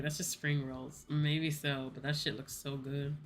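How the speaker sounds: ordinary delivery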